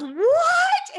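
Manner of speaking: high, loud shocked yell; yelled what. Retold or imitated. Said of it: "And I yelled".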